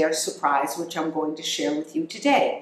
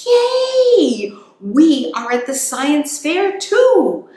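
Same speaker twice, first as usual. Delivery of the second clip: much higher, louder slow joyful shout; cheering; 10 semitones higher, 9 dB louder, slower than usual. Acted. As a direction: cheer like tiny hamsters at the fair. Hamsters cheer at fair.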